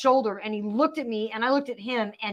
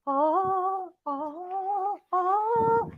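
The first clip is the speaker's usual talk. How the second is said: dreamy sing-song; 8 semitones higher, 4 dB quieter, much slower than usual. Retold or imitated.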